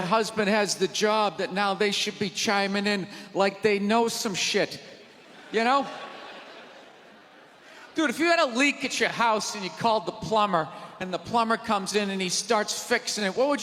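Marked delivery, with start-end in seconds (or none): none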